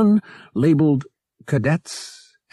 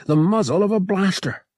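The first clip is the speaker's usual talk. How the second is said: ordinary delivery, nothing marked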